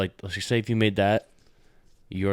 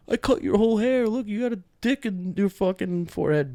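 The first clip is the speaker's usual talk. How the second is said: mock prankster taunt; 12 semitones higher than usual. Mock prank boast to brother.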